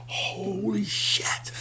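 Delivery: slow, high whisper of awe; awed whisper. Retold or imitated. Watching the launch with Jake, a slow awed whisper. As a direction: breathe it out in disbelief. Both watching the jump land.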